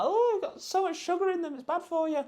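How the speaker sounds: very high, whiny mock complainer; squeaky sarcastic mimic of critics; mocking Karen voice. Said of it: "Karens always bash me".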